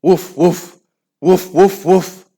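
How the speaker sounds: loud barking woof; barking dogs. Acted. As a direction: bark like big dogs. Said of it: "growled the dogs".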